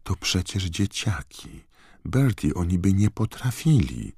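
high, hushed woman's voice; softly pleading witch. A narrator's reading. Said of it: "szepnęła czarownica".

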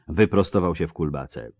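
plain reading voice

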